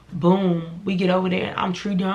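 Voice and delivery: ordinary talk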